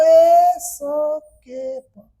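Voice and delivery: very slow, each sound of escape pulled apart; slow syllable spelling. Shown as a demonstration. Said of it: "escape लिखना है". (एक-एक ध्वनि अलग करके बहुत धीरे बोलना)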